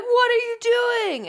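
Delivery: high, loud, slow drawn-out cry; mock horrified disbelief. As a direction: imitate an appalled onlooker, stretching it out. Imitating onlookers after thrown phone.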